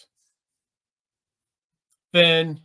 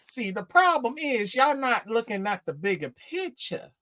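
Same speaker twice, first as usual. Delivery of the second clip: Booty Master. Acted. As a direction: explain it like a self-styled pimp. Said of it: "talking like the Booty Master".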